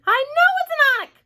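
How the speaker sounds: very high, loud shriek; panicked scream. A narrator's reading. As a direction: scream it in horror. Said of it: "screamed the head of the fire department".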